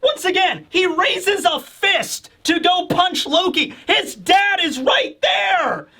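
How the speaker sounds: slow, high-pitched outraged disbelief; incredulous. Real, not acted. Host appalled that he'd punch the king's son, incredulous. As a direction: stretch each word out in high disbelief. Incredulous at merchant's nerve before king.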